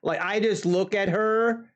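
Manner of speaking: ordinary talk, nothing marked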